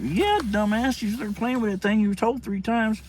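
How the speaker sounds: retold exasperated comeback; big brother scolding. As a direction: tell off a little brother. Older brother scolds younger.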